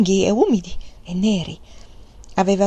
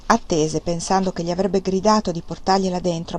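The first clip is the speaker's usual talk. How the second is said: usual speaking voice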